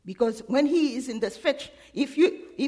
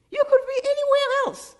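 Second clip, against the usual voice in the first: exclaiming; 13 semitones higher, 4 dB louder than usual. Real, not acted. Preacher exclaims, pitch leaps.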